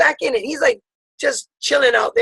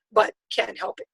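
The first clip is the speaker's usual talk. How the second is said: ordinary talk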